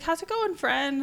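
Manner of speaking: high sing-song hello; cheery greeting. Retold or imitated. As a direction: greet a friend brightly from afar. Cheerful rider greeting her.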